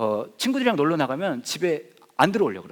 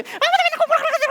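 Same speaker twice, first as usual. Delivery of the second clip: high fast mock whining; 엄마에게 투덜대는 딸, 높은 목소리; whiny daughter; 6 dB louder, faster than usual.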